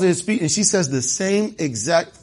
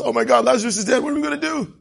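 high-pitched pretend alarm; mock panic; 7 semitones higher, faster than usual. Preacher mimics a panic Jesus never had, mock-frantic. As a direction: climb higher into a hammed-up fret.

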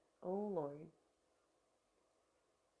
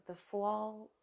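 New York accent on fall. Shown as a demonstration. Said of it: "fall in a New York accent that I've never used".

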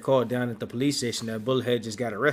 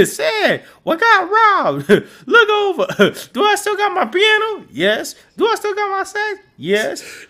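very high, loud frantic cry through laughter; mock panicked wailing; 12 dB louder than usual. [name] finds his living room trashed, mock panicked wailing. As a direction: wail it in hammed-up alarm, half laughing.

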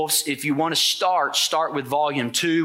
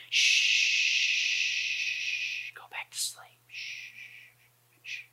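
put-on hushed lullaby whisper to a sleeping child; whispering over a crib; 3 semitones lower, slower than usual. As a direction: soothe a baby back to sleep in a whisper. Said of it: "It's like the thing with Jesus".

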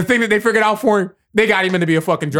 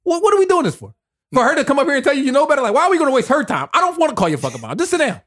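mock teacher scolding; 6 semitones higher, faster than usual. Imitating teachers scolding him.

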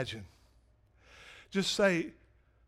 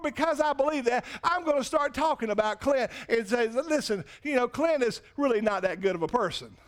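high, loud preaching climax; full-force preaching; 9 semitones higher, 5 dB louder than usual. Acted. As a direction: preach it hard to the church.

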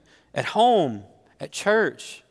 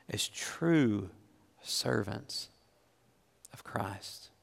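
breathy hushed whisper for the key words; 4 semitones lower, 8 dB quieter, much slower than usual. Real, not acted. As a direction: drop to a whisper for emphasis. Preacher drops to a hush.

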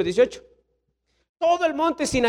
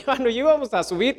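ordinary talk, nothing marked

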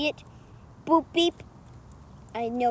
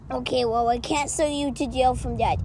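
ordinary talk, nothing marked

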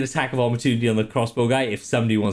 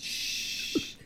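shushing hiss; 11 dB quieter than usual. Acted. Shushing while smothering.

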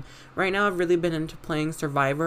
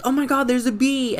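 mock panic; 8 semitones higher, 5 dB louder than usual. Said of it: "we used to be like".

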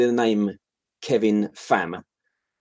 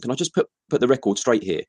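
ordinary delivery

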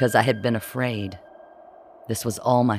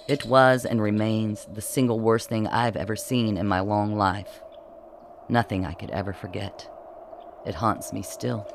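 usual speaking voice

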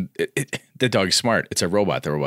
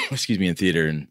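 ordinary delivery

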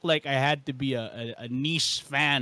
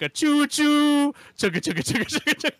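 choo choo then fast chugging; chugging toy train voice; 11 semitones higher, 3 dB louder than usual. Retold or imitated. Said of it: "like a train sound".

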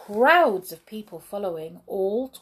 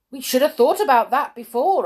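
loud, quick, higher retort; cross; 6 semitones higher, 8 dB louder, faster than usual. A narrator's reading. Judge answering the worried driver, cross retort. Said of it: "answered the judge crossly".

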